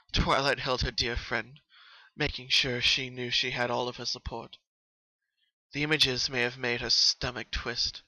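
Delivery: usual speaking voice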